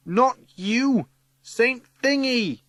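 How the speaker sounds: high, drawn-out shout; impatient shout. A narrator's reading. Teggy correcting Sir and calling the saint, shouting. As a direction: shout past him to someone else. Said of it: "shouts Teggy".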